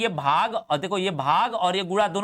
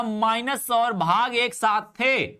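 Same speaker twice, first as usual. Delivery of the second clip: slow emphasis; 3 semitones higher, much slower than usual. Shown as a demonstration. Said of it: "repeat for emphasis".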